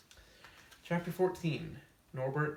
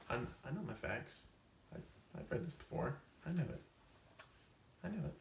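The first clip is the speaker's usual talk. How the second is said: defensive muttering; 3 semitones higher, 7 dB quieter, faster than usual. Acted. Defensive insisting on wrong facts.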